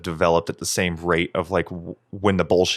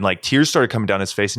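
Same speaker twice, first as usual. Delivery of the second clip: usual speaking voice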